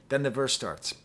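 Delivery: usual speaking voice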